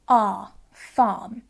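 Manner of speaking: "R, farm" with a silent r, long open ah; southern England "R, farm", no r sound at all; southern English pronunciation. Shown as a demonstration. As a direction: say "R, farm" non-rhotic, as "ah, fahm". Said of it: "the word farm in my accent".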